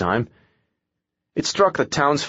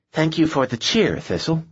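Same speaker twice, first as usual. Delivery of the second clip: higher sharp sarcastic snap; snapping; 6 semitones higher than usual. Acted. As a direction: bite back sarcastically. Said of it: "Gabrielle snapped".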